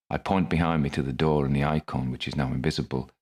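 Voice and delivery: plain reading voice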